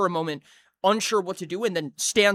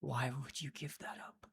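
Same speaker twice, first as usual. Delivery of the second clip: whisper; 4 semitones lower, 15 dB quieter than usual. Acted. Witch whispers to player.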